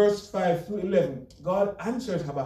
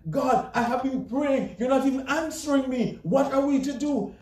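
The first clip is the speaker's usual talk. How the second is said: crying out to God; 8 semitones higher than usual. Acted. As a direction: lift the voice in a pleading prayer. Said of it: "some of us are crying out to God".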